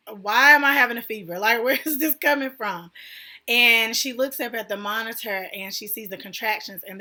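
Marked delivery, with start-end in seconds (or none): 0.1-2.9 louder, fast alarmed question with a laugh; alarmed outburst. Retold or imitated. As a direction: blurt it out in alarm, half laughing. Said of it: "I'm like".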